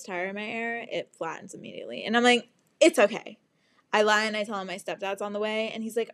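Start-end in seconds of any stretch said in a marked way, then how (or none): 2.8-3.4 louder, brisk cover-up; forced reassurance. Retold or imitated. Stranded driver hiding tears from helper, forced reassurance. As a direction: insist firmly that you are fine. First brave reply to the man.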